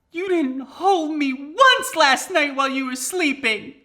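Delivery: high, loud, slow mock wail; mock bawling. Girlfriend accusing boyfriend after the shower, mock bawling. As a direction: wail it like a hammed-up crybaby.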